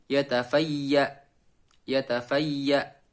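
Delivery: slow "yatafayya" ending firmly pressed on the stop. Shown as a demonstration. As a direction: press down hard on the end of "yatafayya". ("yatafayya" diwaqafkan dengan ditekan)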